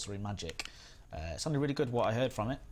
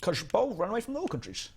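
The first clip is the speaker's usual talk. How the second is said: mock Dutch accent; 9 semitones higher than usual. Shown as a demonstration. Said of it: "That's how you sound".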